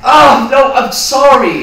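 very loud, high wail of apology; anguished apology. Acted. Evicted roommate begging forgiveness, anguished. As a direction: cry out for forgiveness at full voice.

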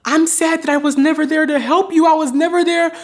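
high, tearful mock-mom lament; imitated sobbing. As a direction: wail the regret like a self-pitying mom. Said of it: "she was sobbing".

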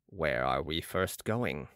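a calm, grave Balsamo; Balsamo's voice. A narrator's reading. Balsamo voiced low by narrator.

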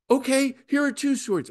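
high, mock-eager offer; eager disciples. Disciples offering Jesus their swords, high and mock-eager.

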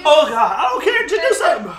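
high, loud pleading; desperate shout. Real, not acted. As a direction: yell it out desperate. Burning mouth, begging for help.

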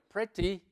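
"pretty" with an e and crisp t, PREH-tee; British-style pronunciation. Shown as a demonstration. Teacher showing how some people say it, "pretty" as PREH-tee. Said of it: "le pronuncian así pretty".

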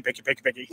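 soft, quick putter of a small outboard motor; imitating boat motor. Guest telling host about Peruvian boats, imitating motor putter. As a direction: mimic the motor chugging softly. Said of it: "'cause the motors go pecky".

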